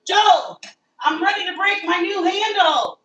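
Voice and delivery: high, loud wail of alarm; real dismay, voice jumping high; distressed cry out. Real, not acted. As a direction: cry out to Joe, half-laughing in panic. Handle popping off new pan.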